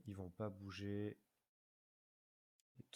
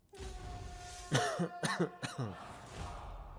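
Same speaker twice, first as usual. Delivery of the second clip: coughing fit after swallowing wrong; hacking cough, something went down wrong; choking cough; 8 semitones higher, 5 dB louder than usual. Real, not acted. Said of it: "j'ai avalé de travers". (toux d'étouffement)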